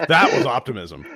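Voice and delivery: said through a laugh; chuckling as you say it; amused, laughing aloud. Real, not acted.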